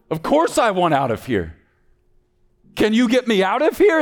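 high, loud, urgent cry; desperate plea. Acted. Orphan begs the old man for freedom, loud and desperate. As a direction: plead at full pitch to be let out.

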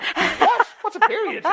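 very high squeaky disbelief; a bewildered boy's high squeak; mock clueless boys. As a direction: squeal it like a baffled kid. Boys' clueless question, very high.

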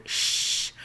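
soft, voiceless shushing sound; conspiratorial shush. Pretend. Letter writer confessing to using a work computer, playful shush. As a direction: shush as if keeping a secret.

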